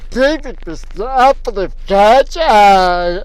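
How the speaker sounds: high, loud, whining mockery of the verse; mocking Bible quote. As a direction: wail the verse in disbelief. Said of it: "it says in the Bible".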